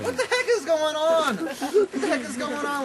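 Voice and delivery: high, loud outraged shout; shocked diners. Acted. Restaurant crowd reacting to the fart, high loud shout.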